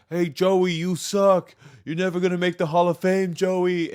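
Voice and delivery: loud, high taunting call from the stands; imitated heckling. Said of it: "you were just going, like".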